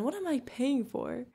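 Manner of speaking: ordinary delivery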